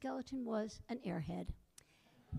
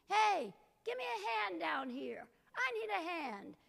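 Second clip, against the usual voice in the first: Jack calling up; 6 semitones higher than usual. A narrator's reading. Jack calls up the chimney.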